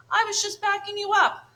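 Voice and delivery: high, loud whining defence; sulky little brother backing off; whiny protest. Acted. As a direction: brother bear whining at his sister.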